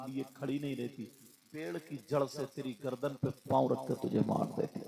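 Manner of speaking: as if heard from across the hall; faint far-off storytelling; distant narration. Acted. Distant recorded narration segment.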